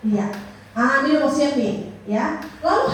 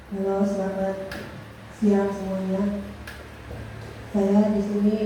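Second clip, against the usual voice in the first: flat delivery; 6 semitones lower, 5 dB quieter than usual. Shown as a demonstration. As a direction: greet the room with no variation at all. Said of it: "Misalkan kayak saya nih".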